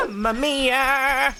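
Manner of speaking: high, slow, stretched Italian plumber cry; Luigi impression. Streamer joking about Luigi, high slow Italian cry. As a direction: Mamma mia like Luigi.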